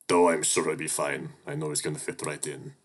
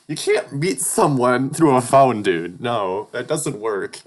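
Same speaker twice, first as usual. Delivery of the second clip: loud, high, slowed-down exasperation; loud, frantic self-correction; panicked rant; 6 semitones higher, 10 dB louder, slower than usual. Real, not acted. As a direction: rant at yourself in rising panic. Mini panic spiral.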